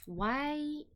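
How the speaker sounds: ordinary talk, nothing marked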